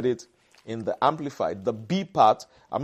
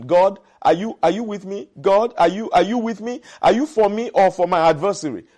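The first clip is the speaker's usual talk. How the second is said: higher-pitched, mock pleading questions; doubtful questioning; 5 semitones higher, 4 dB louder than usual. Imitated believer questioning God in adversity, doubtful. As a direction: imitate a doubter pressing for an answer. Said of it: "you are questioning".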